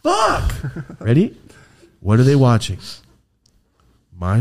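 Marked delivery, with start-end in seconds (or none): 0.0-0.5 very high squeaked curse; mock frustration. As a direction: squeal the swear in comic frustration. Comic frustration at conflicting stories.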